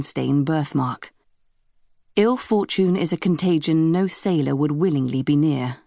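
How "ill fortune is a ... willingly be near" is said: ordinary delivery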